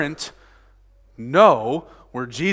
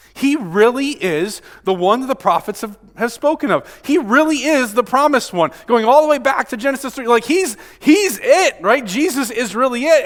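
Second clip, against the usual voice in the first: higher, louder, building excitement; excited believers; 7 semitones higher, 5 dB louder than usual. Acted. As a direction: voice the crowd's mounting excitement. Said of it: "people are getting excited about this".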